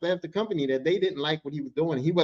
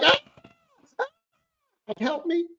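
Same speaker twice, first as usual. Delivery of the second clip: pitiful high "help me" from behind glass; mock cry for help; much slower than usual. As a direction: play a trapped man whimpering for rescue. Man pressed to window screaming.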